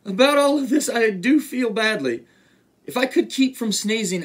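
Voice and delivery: high-pitched apologetic elephant, mildly sad; a touch of sorrow. A narrator's reading. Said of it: "said the elephant sadly".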